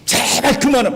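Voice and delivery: high, loud outburst of exasperation; acted fury. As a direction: act out an enraged king shouting for it to stop. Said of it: "얼마나 열받았는지".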